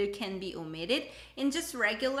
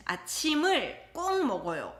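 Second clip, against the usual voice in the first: 아침을 꼭 먹어요 with 꼭 pushed higher and faster; emphatic stress; 6 semitones higher, much faster than usual. Shown as a demonstration. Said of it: "to emphasize".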